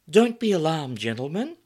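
high, loud, breathy-free whimsical purr; whimsical sex kitten. Acted. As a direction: welcome them with playful whimsy. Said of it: "said with an air of whimsy".

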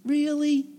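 high, teasing sneer of a serpent; high, taunting serpent doubting God's word to Eve; mocking serpent.

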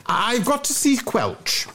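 high, stuttering schoolboy plea; Bunter. Acted. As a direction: plead urgently, tripping over the first word. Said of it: "gasped Bunter".

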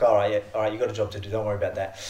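low, soft self-talk to settle down; calming self-talk. Retold or imitated. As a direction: talk yourself back into focus, low and soft. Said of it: "It's like".

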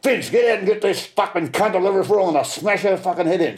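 high, heated mock yell; imitated furious call, pitched well up; retold angry yell. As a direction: re-enact yelling a threat for help. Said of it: "And I yelled out".

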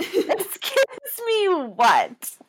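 drawn-out, high affronted retort; hammed-up indignant comeback, stretched slow and high; mock indignation. Co-host echoes contestant's reply to Peter, exaggerated indignation. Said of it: "that is what she said".

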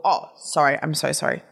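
ordinary talk

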